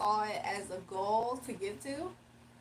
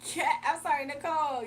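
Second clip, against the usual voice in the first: usual speaking voice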